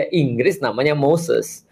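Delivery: ordinary delivery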